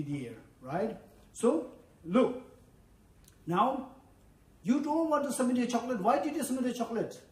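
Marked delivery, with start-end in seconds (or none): none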